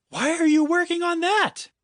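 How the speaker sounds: high urgent shout. Acted. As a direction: yell at him in panic. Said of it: "shouted Finn".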